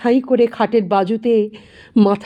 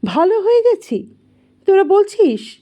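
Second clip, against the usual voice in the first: dazed disbelief; 7 semitones higher than usual. A narrator's reading. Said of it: "ফ্যালফ্যাল দৃষ্টিতে তাকিয়ে বলেন".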